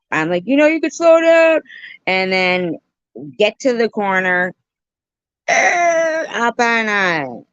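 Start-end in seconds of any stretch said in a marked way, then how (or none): 0.5-1.6 high, loud mock protest; mock-panicked plea over a speeding ride; retold plea. Retelling her plea to slow down. 5.5-6.3 high tire-screech noise; drawn-out high "errr" squeal; imitated screech.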